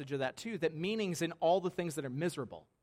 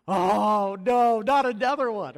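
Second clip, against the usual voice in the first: dejected groan; 5 semitones higher, 13 dB louder, slower than usual. Retold or imitated. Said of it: "literally all of them are dejected".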